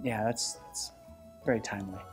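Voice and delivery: ordinary talk, nothing marked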